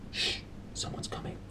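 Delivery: hushed, unvoiced warning; urgent whisper. A narrator's reading. Pan warning Lyra of someone coming, whispered. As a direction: whisper fast so the intruder won't hear. Hiding in wardrobe, someone approaches.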